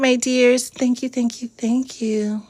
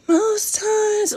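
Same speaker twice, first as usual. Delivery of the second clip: high exclaim; 13 semitones higher than usual. Real, not acted. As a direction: jump way up in pitch for emphasis. Repeats phrase whispered then high.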